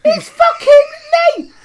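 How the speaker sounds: very high, loud, slow wail; imitating an outraged squawk through laughter; mock outraged shout. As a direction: yell it in hammed-up disbelief, cracking up. Imitates friend's outraged insistence, laughing.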